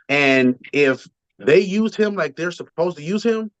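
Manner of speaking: normal talking voice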